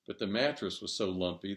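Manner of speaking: ordinary delivery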